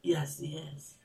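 soft whispered amen. Real, not acted. Preacher affirming Jesus' words, whispering. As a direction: breathe it out. Solemn affirmation after scripture.

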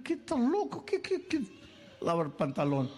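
high, laughing mock disbelief; mock incredulous woman. Preacher voicing the girl's baffled reply, laughing. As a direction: play the baffled girl, laughing. Woman reacts to absurd proposal.

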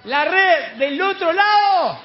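high, slow, stretched holler; insistent calling shout. Acted. The Lord insisting from the shore, long-drawn shout. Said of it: "sigue el Señor insistiendo".

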